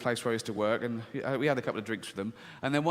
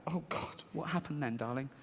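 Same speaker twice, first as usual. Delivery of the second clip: whispered father; 9 dB quieter than usual. Acted. Father pressing, breathless.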